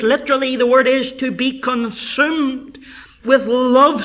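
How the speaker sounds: driving, emphatic pulpit delivery; impassioned preaching. Acted. Preacher tells congregation of David's longing for God, impassioned preaching. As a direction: preach with mounting fervour.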